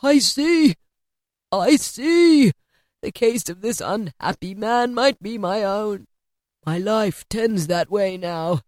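horrified Scrooge; shuddering dread. A narrator's reading. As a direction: cry out, then sink into slow, appalled words. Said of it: "shuddering from head to foot".

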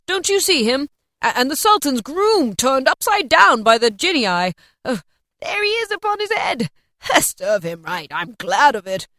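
loud, eager rush of recognition; gleeful, emphatic old Scrooge reliving his stories; heightened, excited. A narrator's reading. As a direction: between laughing and crying with excitement. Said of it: "in a most extraordinary voice between laughing and crying".

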